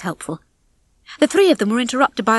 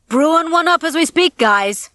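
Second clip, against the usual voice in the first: higher, breezy call; calling back; 7 semitones higher, 4 dB louder than usual. A narrator's reading. Rocky calls back to the rats, breezy call. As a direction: call back over your shoulder. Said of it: "Rocky called back to him".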